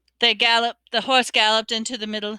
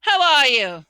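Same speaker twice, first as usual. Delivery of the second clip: loud, hearty hail; roaring; 4 semitones higher, 5 dB louder than usual. A narrator's reading. Headless huntsman greeting his rival, roaring. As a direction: boom out a falling greeting. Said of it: "he roared".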